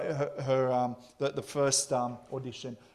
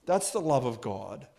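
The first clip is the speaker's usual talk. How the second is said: ordinary talk, nothing marked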